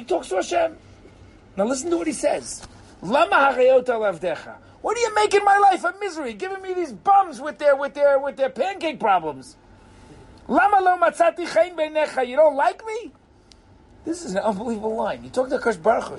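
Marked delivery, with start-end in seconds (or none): 3.0-13.1 high-pitched, indignant protest; worked-up, aggrieved wail of protest; exasperated complaint. Acted. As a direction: plead your grievance, pitched way up. Moses' complaint to God, acted.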